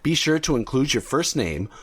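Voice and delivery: ordinary talk, nothing marked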